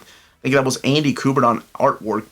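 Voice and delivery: ordinary talk, nothing marked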